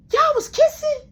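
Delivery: put-on very high, loud squeaky kid voice; high-pitched childish gasp of disbelief; kid brother's shocked squeal. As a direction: squeal it like a shocked little boy. Little brother catching them, shocked.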